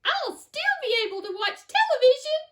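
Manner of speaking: put-on high, loud squeak of a tiny boy; squeaky tiny voice. A narrator's reading. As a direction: squeak it defiantly. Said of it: "squeaked the tiny little voice".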